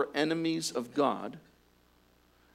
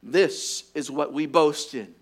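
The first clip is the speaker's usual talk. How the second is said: ordinary delivery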